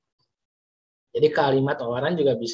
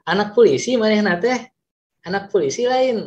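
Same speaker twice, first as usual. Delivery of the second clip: anak polisi manehna teh asked higher, with a rise on polisi; higher questioning read; question intonation; 7 semitones higher, 4 dB louder than usual. Shown as a demonstration. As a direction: lift it into an eager question. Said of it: "coba dijadikan kalimat penanya".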